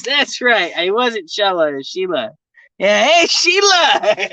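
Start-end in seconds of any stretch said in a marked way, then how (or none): 2.8-4.2 high, drawn-out Australian call; mock Australian catcall breaking into laughter; put-on Aussie accent. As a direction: holler it like a hammed-up Aussie.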